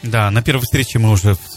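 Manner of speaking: normal talking voice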